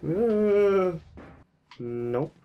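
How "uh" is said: scared horror game streamer. Real, not acted. Startled by something in game.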